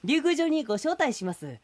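turtle sales pitch. Acted. Turtle pitches Ryugu castle.

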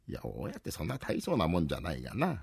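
ordinary talk, nothing marked

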